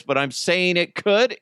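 ordinary delivery

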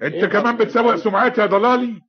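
high, loud angry outburst; outraged accusation. Acted. Cheated man answering public slander, outraged. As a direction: explode at the slander.

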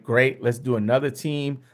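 usual speaking voice

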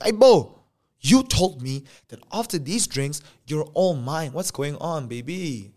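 loud, high hey then pressing complaint; confronting shout. Retold or imitated. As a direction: call her out, hurt and loud. Confronting girlfriend after drinks.

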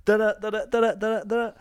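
ordinary talk, nothing marked

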